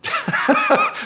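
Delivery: short burst of high laughter. Real, not acted. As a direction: laugh in disbelief. Laughs after booking it.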